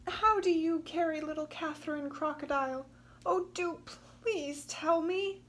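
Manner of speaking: Katy begging the crocodile; eager pleading. Acted.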